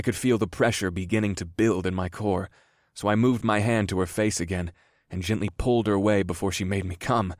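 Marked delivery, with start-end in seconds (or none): none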